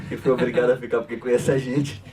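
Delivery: joking through a laugh; real chuckle running into the words. Real, not acted. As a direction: tease the audience, laughing as you speak. Joking thanks to captive friends.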